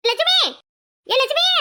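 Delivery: high, drawn call for a neighbour. Acted. Calling out angrily for the neighbour. (உரக்கக் கூப்பிடுதல்)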